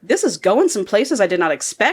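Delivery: normal talking voice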